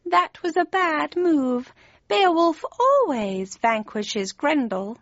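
high, slow, stretched cat voice; gloating cat. A narrator's reading. Cheshire Cat on Kane's bad move, high and slow. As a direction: savour it high and drawn out.

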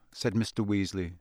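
usual speaking voice

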